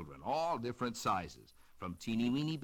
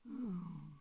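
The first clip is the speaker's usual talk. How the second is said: soft sigh; 6 semitones higher, 6 dB quieter than usual. Acted. Octopus wishing for instruments.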